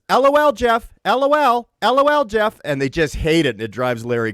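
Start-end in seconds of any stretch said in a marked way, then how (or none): 0.1-2.6 high sing-song L O L, much higher; mock girlfriend. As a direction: tease like a chirpy girlfriend. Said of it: "and she says".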